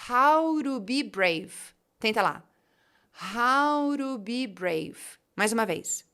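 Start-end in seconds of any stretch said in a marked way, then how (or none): 0.1-1.5 slow "How to be brave" as "hauro bi breiv"; connected speech. Shown as a demonstration. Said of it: "a frase vai ficar". 3.3-5.0 slow "How to be brave" as "hauro bi breiv"; connected speech. Shown as a demonstration. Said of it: "a frase vai ficar".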